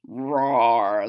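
low playful roar; mock lion roar. Reader roars like a lion for the child. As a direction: growl like a pretend lion.

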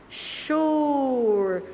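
"Chur" held with a strong U; syllable emphasis. Shown as a demonstration. Teacher showing pupils the strong U, stressed "Chur". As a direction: lean on the U in "Chur". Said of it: "esse som mais forte do U".

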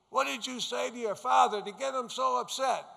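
high, loud scolding mother voice; retelling his mother on the phone, high and loud scolding. Retold or imitated.

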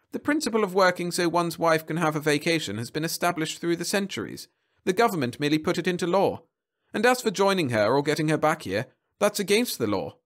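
high-pitched official reciting rules; bureaucrat. A narrator's reading. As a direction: lecture the caller on the law.

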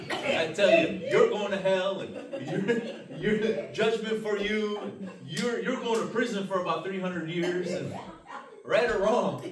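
loud, high, hammed-up condemnation; mock judgement. Preacher to congregation, playing the Lord sentencing people, loud mock judgement. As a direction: boom it out like a joking judge.

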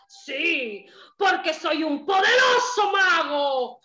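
booming villain laugh with boasting; ogre's evil laugh. Acted. The ogre boasts to the cat, evil laughing. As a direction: cackle like a proud ogre. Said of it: "Y el ogro dijo".

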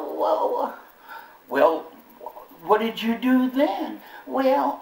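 ordinary talk

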